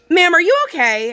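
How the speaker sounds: imitating a nosy woman asking; mock concerned stranger. Annoyed mom mimics stranger at the car: prim, high concern. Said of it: "this lady looks at me and she goes".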